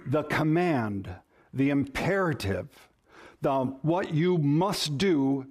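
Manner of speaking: usual speaking voice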